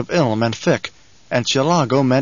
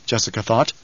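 ordinary delivery, nothing marked

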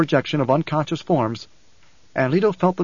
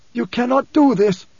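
high, slow, strained objection; hissed protest; 10 semitones higher, 4 dB louder than usual. A narrator's reading. Preacher hisses at Leto to stop, high and emphatic. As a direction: forbid it in a raised, drawn-out voice. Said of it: "the preacher hissed".

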